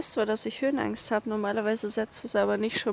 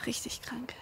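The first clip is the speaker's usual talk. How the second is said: stunned, soft whisper; fassungsloses Flüstern; 11 dB quieter than usual. Real, not acted. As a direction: whisper it in disbelief. Stunned after falling stones. (fassungslos geflüstert)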